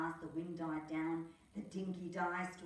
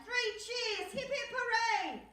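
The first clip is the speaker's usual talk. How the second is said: high, louder cheer; 7 dB louder than usual. Acted. Crew celebrating the redesigned boat, high loud cheer. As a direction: hip hip hooray, all-out.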